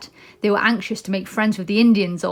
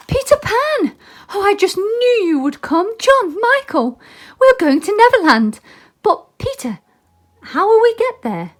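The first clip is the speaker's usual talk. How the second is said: put-on high, louder thrilled girl voice; excited girl; 12 semitones higher, 6 dB louder than usual. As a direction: squeal with joy as young Wendy. Wendy thrilled to see Peter.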